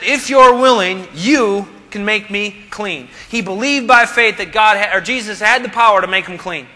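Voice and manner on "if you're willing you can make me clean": slow, humble request; humble plea. Retold or imitated. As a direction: ask it slowly and humbly. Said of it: "He said".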